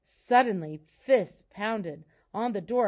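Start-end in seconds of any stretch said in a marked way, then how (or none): none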